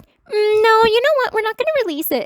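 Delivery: high, loud, breezy brush-off; mock dismissive studio boss. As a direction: mimic a careless executive waving it away. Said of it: "You're just like".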